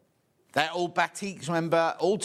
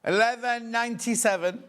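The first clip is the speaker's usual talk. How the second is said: bright, high, emphatic price announcement; price call; 8 semitones higher than usual. Real, not acted. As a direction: sell it to the viewers. Announcing price to live viewers.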